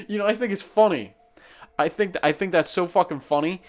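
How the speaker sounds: high, loud laughing talk; laughing while talking. Real, not acted. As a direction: still cracking up as you speak. Laughing at the punchline.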